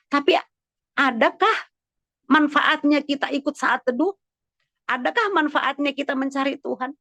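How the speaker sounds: high, insistent preacher's questioning; rhetorical preaching. Real, not acted. Pastor asking congregation if seeking God pays, insistent preaching. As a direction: challenge the congregation with a lifted voice.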